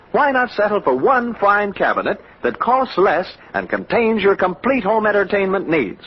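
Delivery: normal talking voice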